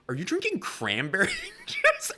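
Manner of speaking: high incredulous quote broken by a laugh; laughing mock callout. Comic quoting the headliner who busted him onstage, laughing. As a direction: laugh through the accusation.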